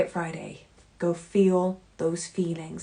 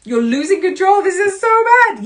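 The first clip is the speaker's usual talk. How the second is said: mocking scold; 6 semitones higher, 12 dB louder than usual. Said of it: "Toad, and Bob clawed at it".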